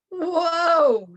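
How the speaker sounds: high, loud yelp with a laugh; startled whoa. A narrator's reading. Kitten yanked by a rope, a loud laughing whoa. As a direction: yelp as a rope yanks you. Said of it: "said Scapito as he flew".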